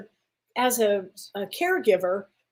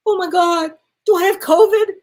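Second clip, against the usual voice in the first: higher, louder alarmed question; retold panic; 8 semitones higher, 9 dB louder than usual. Patient to infectious disease doctor, alarmed. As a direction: blurt it out in mock alarm.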